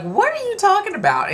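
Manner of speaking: high, baffled question. Retold or imitated. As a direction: act confused at a strange remark. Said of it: "I was like".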